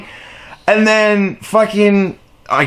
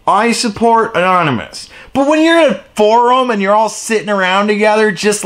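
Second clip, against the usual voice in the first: ordinary talk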